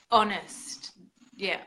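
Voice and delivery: ordinary delivery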